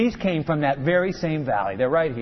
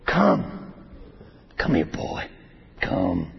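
menacing giant; 13 semitones lower, 4 dB quieter, slower than usual. Acted. Goliath beckoning David menacingly.

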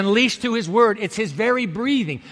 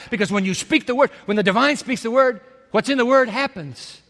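ordinary delivery

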